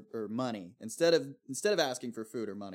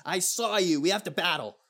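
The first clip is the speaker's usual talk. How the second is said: Pokemon battle challenge; 5 semitones higher, 5 dB louder than usual. Pretend. Mocking a Pokemon trainer challenge.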